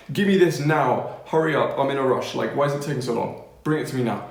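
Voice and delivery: pushy, impatient customer act; mock rude demand. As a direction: play at bossing a waiter around. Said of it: "You need to be more rude".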